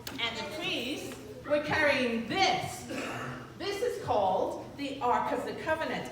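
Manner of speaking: plain reading voice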